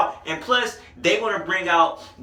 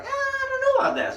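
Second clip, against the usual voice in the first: doubtful squeak. Retold or imitated. Voicing doubtful friends.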